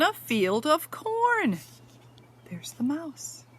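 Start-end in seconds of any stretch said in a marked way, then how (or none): 2.5-3.4 whisper. Real, not acted. Points out mouse to child.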